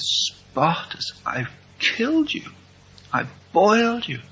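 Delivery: high, strained imitation of a grieving boy; broken-hearted. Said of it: "He was broken-hearted".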